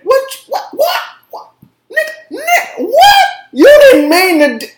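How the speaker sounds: high, loud shouted what, over and over; outraged disbelief. Real, not acted. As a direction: yell it back at them, refusing the excuse. Outraged at the excuse given.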